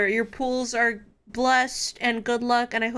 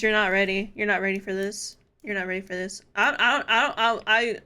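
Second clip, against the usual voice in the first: ordinary talk, nothing marked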